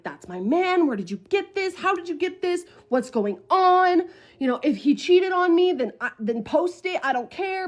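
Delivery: high, agitated demands; worked-up retelling of a freak-out. Retold or imitated. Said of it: "I started freaking out".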